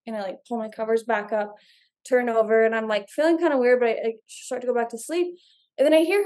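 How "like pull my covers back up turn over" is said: normal talking voice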